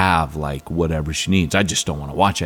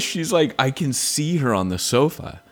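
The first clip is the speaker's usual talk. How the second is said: usual speaking voice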